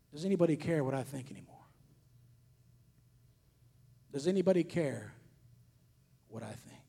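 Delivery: soft, low, grieved question; God speaking softly to the preacher, hushed and sorrowful. Retold or imitated. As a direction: let God's question fall quiet and heavy. God's words quoted, hushed.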